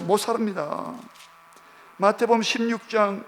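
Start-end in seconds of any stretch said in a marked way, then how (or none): none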